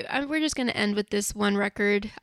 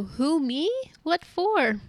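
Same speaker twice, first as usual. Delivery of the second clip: mock indignant teen; 7 semitones higher, slower than usual. Imitating kids asked for IDs.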